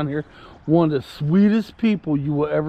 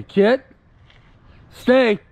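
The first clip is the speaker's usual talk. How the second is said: firm raised call to the dog; dog command demo; 4 semitones higher, 3 dB louder than usual. Shown as a demonstration. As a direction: call the dog to stay, firmly. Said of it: "all I have to do is say".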